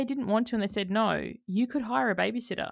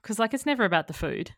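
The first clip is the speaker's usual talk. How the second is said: ordinary talk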